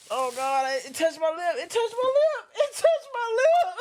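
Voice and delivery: very high laugh-crying squeal; laughing in pain. Real, not acted. Cutting onions, onion touched lip, high laugh-cry squeal. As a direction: squeal through laughter and stinging.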